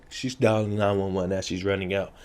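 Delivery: normal talking voice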